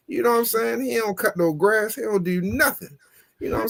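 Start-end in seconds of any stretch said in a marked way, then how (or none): none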